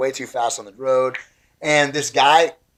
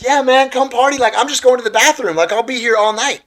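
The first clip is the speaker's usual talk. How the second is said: raised, high-pitched party-guy voice; partying rock star; 11 semitones higher, 5 dB louder than usual. Retold or imitated. Famous drummer inviting a young fan to party, upbeat. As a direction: imitate an upbeat star calling you over. Acting out star's casual invitation.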